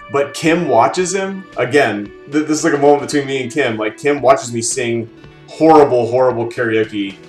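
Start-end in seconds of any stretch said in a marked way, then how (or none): none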